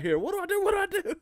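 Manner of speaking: high, laughing mock panic; squeaky pretend alarm; imitating panic. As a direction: replay the frantic scramble with a laugh. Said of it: "I'm, like, panicking".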